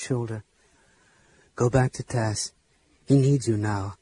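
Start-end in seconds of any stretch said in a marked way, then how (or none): 1.6-4.0 low, soft reassurance; gentle consoling. A narrator's reading. Sturm with a hand on Flint's shoulder, gentle. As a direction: steer him kindly in a lowered voice. Knight consoles the crying dwarf.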